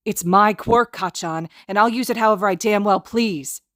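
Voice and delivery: loud defiant growl. Acted. Izuku defying Kacchan over his own quirk, growling. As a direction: dig in and growl it back. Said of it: "Izuku growls back".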